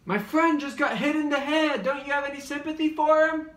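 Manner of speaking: shrill outraged plea from the sidelines; indignant squeaky voice. Retold or imitated. David Lieberhart pleading for his hit friend, high indignant squeal.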